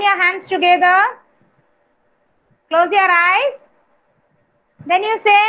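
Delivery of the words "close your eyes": ordinary talk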